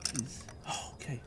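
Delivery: unvoiced breathy prayer; whispered plea. Real, not acted. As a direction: plead under the breath. Praying plug comes out.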